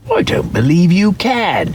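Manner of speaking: high, teasing rabbit voice; doubtful wild rabbit. A narrator's reading. Wild rabbit doubting the toy rabbit, high and teasing. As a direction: needle him, a little sceptical.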